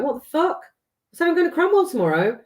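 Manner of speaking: high, disbelieving outburst; can't believe what he's hearing; shocked disbelief. Retold or imitated. Stunned at the boss about the promotion letter, high.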